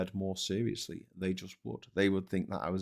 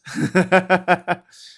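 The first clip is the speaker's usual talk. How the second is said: laughing; 10 semitones higher, 12 dB louder than usual. Real, not acted. Laughs at viewer comment.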